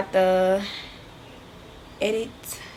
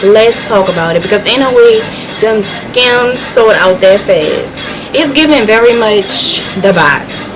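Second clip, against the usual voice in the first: mock rant. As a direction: rant at Kim like a wronged fan. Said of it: "my little rant about Skims".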